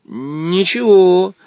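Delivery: slow, steady brave reply. Acted. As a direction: answer bravely. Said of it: "мужественно ответил Женя".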